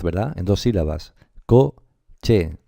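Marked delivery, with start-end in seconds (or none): 1.4-2.6 Coche with a punched, louder first syllable; syllable demo. Shown as a demonstration. Teacher showing students where coche is stressed. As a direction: hit the co of Coche hard. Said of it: "cuando yo digo coche, estoy pronunciando en dos golpes de voz".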